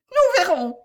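high, loud, tart retort; shrill defiance. A narrator's reading. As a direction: answer back shrilly. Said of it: "elle dit d'une voix aigrelette". (réplique aigrelette et forte)

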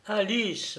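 high, quick call of a name; brisk address. A narrator's reading. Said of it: "me dit-elle vivement". (interpellation vive)

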